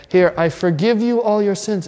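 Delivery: hammed-up close-talking pastor; mocking pastor. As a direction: lampoon an overbearing pastor. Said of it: "get so close to your head".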